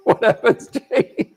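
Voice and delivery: mock squeaky falsetto, laughing; cheeky question answered in a high falsetto. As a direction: play coy in a high squeak. Joking reply to a cheeky question.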